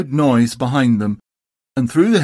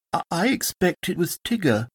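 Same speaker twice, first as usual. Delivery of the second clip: higher, softer, stammering; nervous Piglet; 5 semitones higher, 6 dB quieter than usual. Acted. Piglet timidly names Tigger, soft and nervous. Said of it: "said Piglet nervously".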